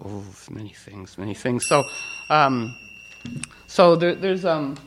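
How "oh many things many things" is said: much softer, almost breathed; hushed knowing murmur. Retold or imitated. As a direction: say it low like a quiet secret. Mystical hushed reply to woman.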